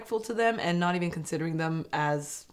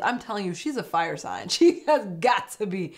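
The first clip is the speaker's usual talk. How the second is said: normal talking voice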